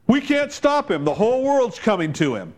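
exaggerated quote. Pharisees panicking about Jesus, exaggerated. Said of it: "they say in an exaggerated statement".

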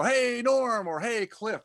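mock cheerful Cheers-style hello; friendly bar greeting. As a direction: hail a regular walking into the bar. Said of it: "and everybody go".

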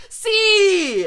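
high, piercing held shout; Nelson. Retold or imitated. Little Spanish speaker answering the nonsense, high drawn-out yell. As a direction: yell one long gleeful syllable at the top of your range. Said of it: "And Nelson just goes".

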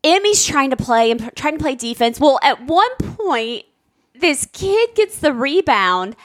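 ordinary delivery

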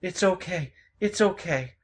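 soothing. Acted. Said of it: "And Gerald".

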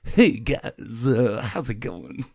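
lightly voiced lazy rock singer greeting. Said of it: "That's how [name] talks".

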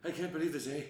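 put-on Canadian accent. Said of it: "the whole Canadian accent thing".

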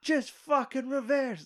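high, slow angry command; angry order. Retold or imitated. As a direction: bark the order to back off. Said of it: "He's like".

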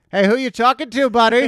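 put-on loud, high Boston accent call; heavy Boston accent, shouted from a car. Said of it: "In his little Boston accent".

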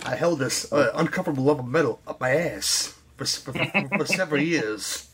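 a light, lightly voiced Walken impression; Christopher Walken impression. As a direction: toss off a bad Walken impression for a laugh. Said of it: "Everybody's got a bad Walken impression".

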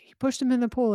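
hammed-up finger-pointing cry; mock accusation. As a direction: imitate a loud tattling accuser. Mimics an accuser blaming Drew.

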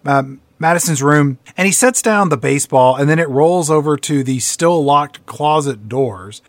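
ordinary talk, nothing marked

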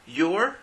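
teacher model of your; your, short rising; model pronunciation. Shown as a demonstration. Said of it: "Number sixty".

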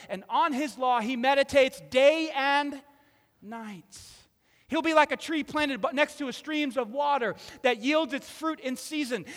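loud preaching voice; full-force preaching. Real, not acted. Pastor preaching Peter's story loud to the congregation. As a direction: preach it out to the room.

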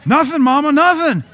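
high, loud yelp of denial; panicked son, hammed up. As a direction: squeal it like a kid caught red-handed. Caught son answers his barging mother.

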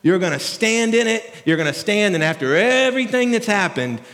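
loud, high sermon shout; rally the church, full voice; preaching at full force. Acted. Pastor rallying his congregation, loud preaching shout.

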